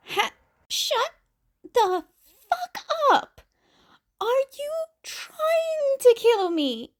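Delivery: high, laughing complaint; laughing protest. Acted. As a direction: laugh through it while begging them to stop.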